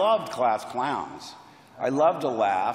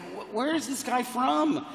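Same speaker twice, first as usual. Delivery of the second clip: high, puzzled question; mildly amazed curiosity; puzzled wonder; 8 semitones higher than usual. Retold or imitated. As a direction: ask in baffled fascination, pitched up. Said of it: "I, I said".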